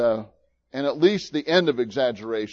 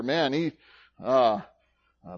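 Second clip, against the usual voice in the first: ordinary talk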